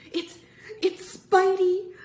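loud, high, stammered alarm; frightened stammer. A narrator's reading. Frightened boy warning friends of the angry man, high loud stammer. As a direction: stumble on the first word, then cry out. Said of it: "Michael stammered".

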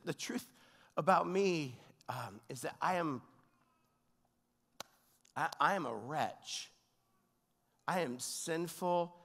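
ordinary delivery

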